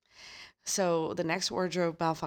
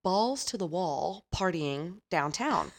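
slow quoted phrase; slower than usual. Retold or imitated. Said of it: "and these are her words".

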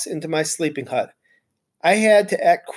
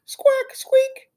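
squeak. Acted. Hamster's voice heard as squeaks.

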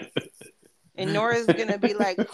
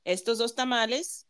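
street vendor call; 2 semitones higher, 4 dB quieter than usual. Shown as a demonstration. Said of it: "you gotta talk like a street vendor".

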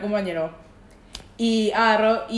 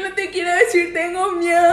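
mock scared voice; 8 semitones higher, 4 dB louder than usual. Joins the mock of the shy confession.

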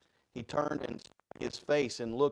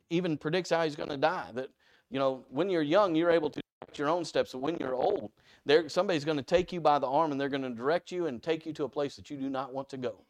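usual speaking voice

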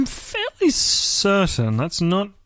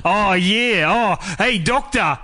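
ocker Australian. Pretend. Australian companion calling the Doctor for help, broad ocker accent. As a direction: hail the Doctor like a laid-back Aussie bloke. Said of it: "Did they talk like that?".

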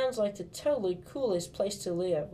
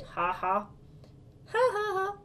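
laughing; 12 semitones higher, slower than usual. Acted. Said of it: "I laughed".

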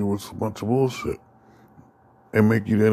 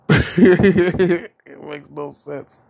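words dragged very slowly through laughter, louder; laughing; 8 semitones higher, 7 dB louder, much slower than usual. Real, not acted. Ranting to listeners about taxes, laughing.

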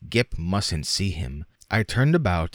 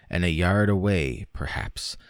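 usual speaking voice

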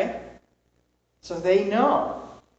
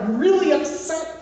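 mock upset; 9 semitones higher, 4 dB louder than usual. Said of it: "Or you're very upset".

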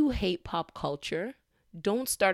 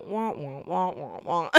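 Charlie Brown teacher voice. Retold or imitated. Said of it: "Charlie Brown teachers in your ear".

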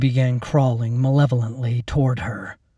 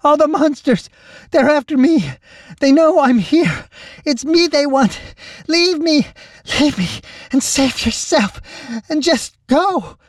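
very high, loud frightened cries; panicked squeals; 5 dB louder than usual. A narrator's reading. Lacey begging the captain to leave her, panicked squealing. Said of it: "The words came out in panicked squeals".

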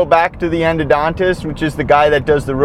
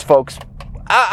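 ordinary talk

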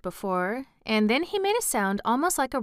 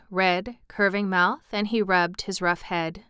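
ordinary delivery

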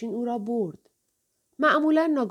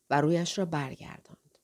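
ordinary delivery

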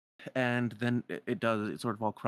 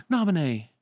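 high, loud exclamation; delighted call; 7 semitones higher, 9 dB louder than usual. Acted. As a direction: call the name on suddenly spotting them. Sora spots Namine and cries out.

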